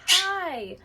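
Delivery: cheerful high greeting. Shown as a demonstration. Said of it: "you came back up".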